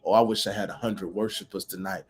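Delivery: ordinary talk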